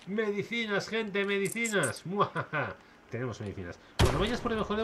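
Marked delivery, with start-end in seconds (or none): 0.1-2.1 mustache twirling villain. Pretend. Gloating over found medicine. 2.1-2.7 hammy villain laugh; evil cackle. Pretend. Villain laugh over loot.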